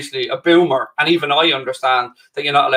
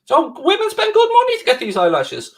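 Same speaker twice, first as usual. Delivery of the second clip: high, preening show-off of the eyelashes; mock vain boast; 14 semitones higher than usual. To Mark, showing off his eyelashes in a high mock-vain voice. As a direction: flaunt it like a beauty queen.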